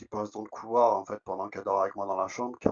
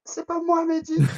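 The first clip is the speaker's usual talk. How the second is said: very high, louder little ghostly voice; spooky tiny voice, much higher and louder; 9 dB louder than usual. Retold or imitated. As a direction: squeak it out like a small unseen child. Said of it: "j'entends une petite voix qui fait".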